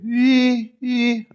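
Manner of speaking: high sustained squeal at the top of the range; demonstrating a maxed-out high voice; highest possible voice. Shown as a demonstration. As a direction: push a thin note up as high as it goes. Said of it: "ça fait que j'ai plus de voix aiguë".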